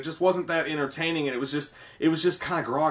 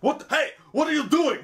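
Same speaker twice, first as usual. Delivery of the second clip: much higher, hammed-up startled outburst; Arnold line mimicked; 11 semitones higher, 3 dB louder than usual. Mimicking Arnold's funny line in the movie, high and hammed-up. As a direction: blurt it high like a comic action hero. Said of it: "It's like".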